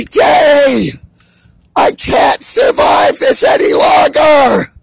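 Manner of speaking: desperate crying shout. Real, not acted. Man screaming to be let out, at Trudeau and everyone, crying shout. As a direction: beg to get out while sobbing and shouting.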